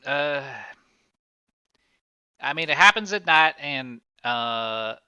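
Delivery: lightly voiced, halting witness with high, loud bursts; evasive witness. Cornered witness excuses his nights out, haltingly evasive. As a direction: stall and fumble toward an excuse.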